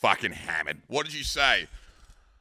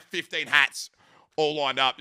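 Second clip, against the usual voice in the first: normal talking voice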